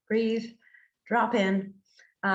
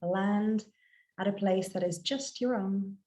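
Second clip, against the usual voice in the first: guided meditation; 4 dB quieter than usual. Acted. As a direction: guide listeners gently inward, phrase by unhurried phrase.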